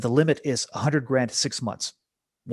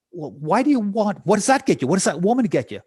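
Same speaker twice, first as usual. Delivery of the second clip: higher, louder, eager probing; pressing questions; 6 semitones higher, 4 dB louder than usual. Retold or imitated. Robbins on stage pressing the guy for his real want. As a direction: push him harder each question.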